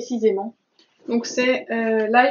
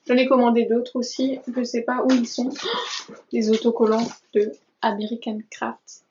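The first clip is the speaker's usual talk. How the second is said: ordinary talk, nothing marked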